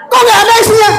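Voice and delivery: very high, startled question; shocked outcry. Acted. Husband finding his wallet emptied by his wife, shocked. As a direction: squawk it high in alarm.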